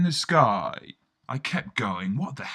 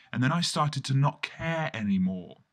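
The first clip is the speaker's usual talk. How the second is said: ordinary delivery, nothing marked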